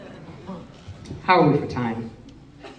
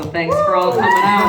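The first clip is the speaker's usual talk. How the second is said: shouted thanks. Real, not acted. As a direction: yell your thanks to the crowd.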